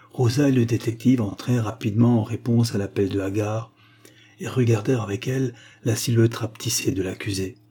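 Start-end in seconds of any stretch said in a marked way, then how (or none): none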